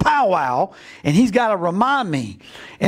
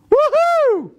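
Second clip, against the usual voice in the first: a high, loud whoop of joy; excited whoop; 4 dB louder than usual. Acted. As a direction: let out a big, thrilled cheer. Samuel thrilled at God's command.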